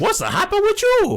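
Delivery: high, loud cartoon goofball voice; Jar Jar Binks impression. Podcast host clowning as Jar Jar, high loud mimicry.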